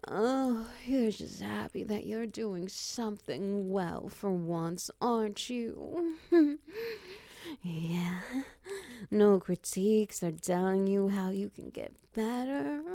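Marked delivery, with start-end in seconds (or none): 6.2-7.2 high breathless giggle; high laugh. Acted. Teasing laugh in roleplay.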